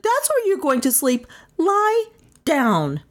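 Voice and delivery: high, scolding command; shouted order. A narrator's reading. Melissa telling Carstairs where to sleep, shouting. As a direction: lay down the law in a high voice. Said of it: "Melissa shouted".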